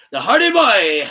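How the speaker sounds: lightly voiced Santino; a hint of Santino's accent; Santino Marella impression. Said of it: "Ares Santino would say".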